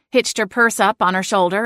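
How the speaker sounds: ordinary delivery, nothing marked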